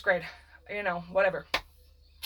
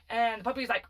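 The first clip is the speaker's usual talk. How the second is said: usual speaking voice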